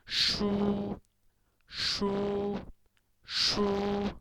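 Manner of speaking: "shu" held long, high and perfectly level; first-tone "shu", no rise or fall; high flat tone. Shown as a demonstration. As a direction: sustain "shu" on one high pitch. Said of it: "shu with first tone, flat tone".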